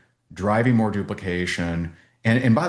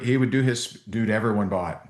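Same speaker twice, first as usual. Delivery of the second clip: usual speaking voice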